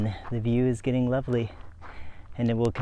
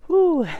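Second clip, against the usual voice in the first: high, louder "whoo" exhale; high whew; 6 dB louder than usual. Real, not acted. Tired cyclist taking a break, high whew. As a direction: let out a high whew to catch breath. Catching breath during a break.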